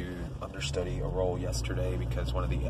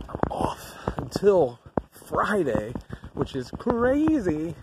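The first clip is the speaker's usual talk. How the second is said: excited; 8 semitones higher, much slower than usual. Real, not acted. Excited about the time off.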